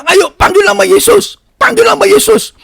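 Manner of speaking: high, drawn-out urgent call; re-enacted pleading cry; urgent exhortation. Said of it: "saya ngomong dengan dia".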